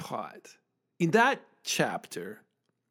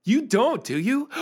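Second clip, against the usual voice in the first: high gasping mock outrage; playful shocked accusing; mock shocked accusation; 8 semitones higher, 3 dB louder than usual. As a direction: act scandalised at a secret.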